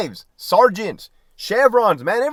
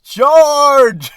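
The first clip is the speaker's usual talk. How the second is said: high, loud hammed-up charge call; mock battle cry; 8 semitones higher, 7 dB louder than usual. Mocking managers who yell from their office, a hammed-up high battle cry. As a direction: play a pompous boss crying charge.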